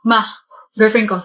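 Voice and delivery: ordinary talk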